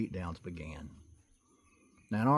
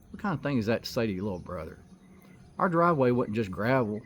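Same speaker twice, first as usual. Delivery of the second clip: ordinary delivery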